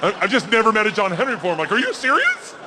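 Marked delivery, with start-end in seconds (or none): 1.7-2.6 high squeaky mock amazement; mock disbelief. Answering the mom about John Henry in high squeaky mock amazement. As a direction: squeal in fake astonishment.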